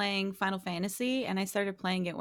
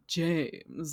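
James voice; 3 semitones lower than usual. Shown as a demonstration. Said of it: "and then go".

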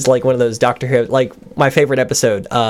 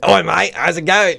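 put-on higher-pitched rough London accent; rough British accent; 6 semitones higher than usual. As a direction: throw out a rough British greeting.

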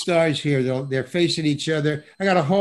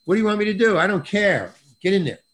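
mock director ordering an actor about, higher and louder; brusque director talk; 6 semitones higher, 5 dB louder, faster than usual. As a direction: hurry him along with a retold order. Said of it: "they're talking to you like that".

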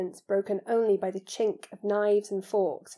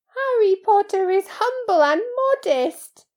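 high, loud adoring elf; awed praise in a high-pitched creature voice; Dobby, reverent; 12 semitones higher, 9 dB louder than usual. A narrator's reading. House-elf extols Harry's modesty, reverent. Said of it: "said Dobby reverently".

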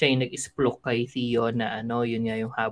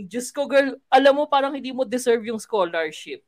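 sassy confrontation; 11 semitones higher, 4 dB louder than usual. Retold or imitated. Retelling Pupa's confrontation.

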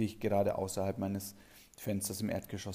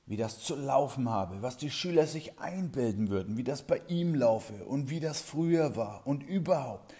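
mild blustering rant; 4 semitones higher than usual. Retold or imitated. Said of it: "Der Chef poltert los".

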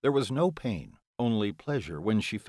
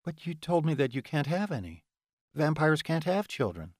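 higher, puzzled woman's voice; female character voice; 7 semitones higher than usual. Acted. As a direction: protest as the heroine, up high. Heroine objects in dialogue.

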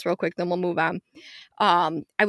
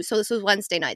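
normal talking voice

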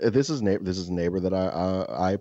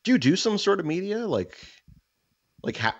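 higher, curious questioning voice; a light higher neighbor voice; 12 semitones higher than usual. Retold or imitated. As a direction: play the neighbor probing, pitched up. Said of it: "And he's just like".

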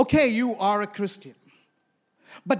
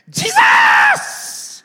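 sudden loud cry of alarm; desperate scream; 14 dB louder than usual. Retold or imitated. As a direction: scream the name as the truck closes in. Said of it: "She just screamed".

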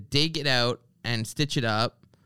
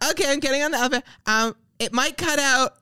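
higher, louder, fast imitation of a woman on her phone; mock her chirpy elevator phone call; 12 semitones higher, 4 dB louder, faster than usual. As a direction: overeager caller telling the phone she's getting in. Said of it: "she's going like".

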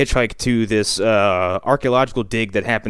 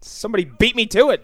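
high-pitched comic indignation; mock exasperation; 11 semitones higher than usual. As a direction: grumble in a hammed-up put-on voice. Acted Bronson, beaten to it.

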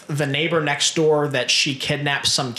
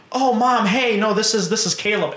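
higher-pitched, eager introduction; eager daughter; 6 semitones higher than usual. Retold or imitated. Daughter introduces Caleb to her mom, mock eager. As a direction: lightly act a daughter piping up to Mom.

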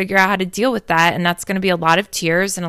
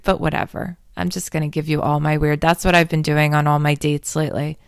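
normal talking voice

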